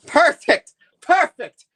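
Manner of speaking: high, loud, laughing delight; thrilled exclamation, real; delighted approval. Real, not acted. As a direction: cheer the performance on. Delighted at the accent audition.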